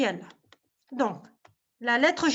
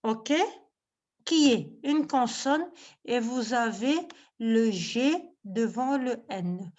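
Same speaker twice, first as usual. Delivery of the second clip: ordinary delivery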